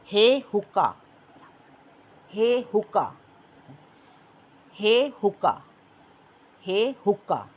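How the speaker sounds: हे stressed before हुक्का; teacher drilling हे हुक्का; repetition drill. Shown as a demonstration. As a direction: repeat हे हुक्का with weight on हे. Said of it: "मेरे साथ पढ़ें".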